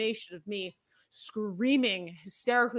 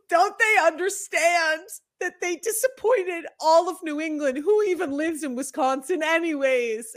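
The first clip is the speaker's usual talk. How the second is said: put-on childhood meltdown; 6 semitones higher, 5 dB louder than usual. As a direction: rant through sobs like a heartbroken nine-year-old. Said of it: "and I was screaming".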